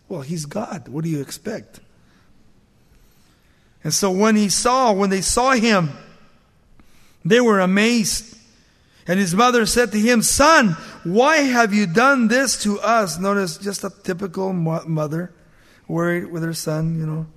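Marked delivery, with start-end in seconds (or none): 3.8-13.2 raised, ringing Bible reading; scripture reading. Acted. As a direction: read the passage out to the congregation. Reads scripture aloud.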